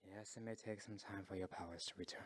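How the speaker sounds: low, near-whispered comfort; hushed, low and soothing; gentle reassurance. Acted. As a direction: reassure very softly. Elder reassures the weakened dragon.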